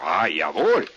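loud German waiter reply; mock German accent. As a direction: agree smartly in fake German. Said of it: "everything I say is in a German accent".

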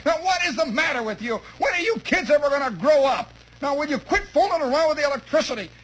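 high-pitched, exasperated mom voice; scolding mother. Acted. Mother with arm round shocked son, exasperated scolding. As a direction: nag and scold like a fed-up mother.

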